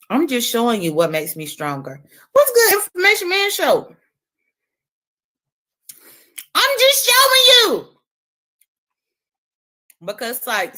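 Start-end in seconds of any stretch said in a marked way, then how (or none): 2.3-7.9 high mock shout-out. Vlogger greets Information Man Show in high mock voice. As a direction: squeak it out high and proud.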